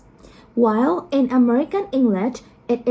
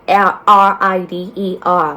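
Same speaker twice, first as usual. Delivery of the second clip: spelling it out; 4 semitones lower, 9 dB louder than usual. Shown as a demonstration. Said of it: "as a word like rider".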